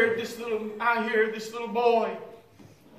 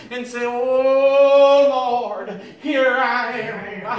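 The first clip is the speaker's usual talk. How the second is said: impassioned sermon building louder, with long cried-out openings; fervent preaching; 6 dB louder than usual. Real, not acted. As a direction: preach it out to the congregation, stretching key words. Preaches with rising fervour.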